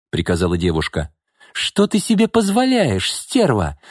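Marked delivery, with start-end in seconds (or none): 1.5-3.8 high-pitched outraged challenge; barking angrily. A narrator's reading. Mother confronting the young woman, barking angrily. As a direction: round on her, mildly barking. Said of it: "рявкнула на неё Нина".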